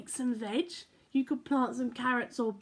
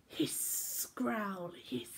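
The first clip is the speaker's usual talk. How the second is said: cat hiss; 4 semitones lower, 8 dB quieter, much slower than usual. Acted. Cats about to fight.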